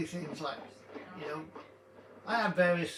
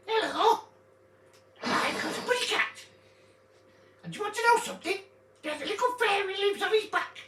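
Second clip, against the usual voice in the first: put-on uncle's high silly voice for a baby; 12 semitones higher than usual. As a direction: squeak it up high to make a baby smile. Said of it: "I come out with these silly voices".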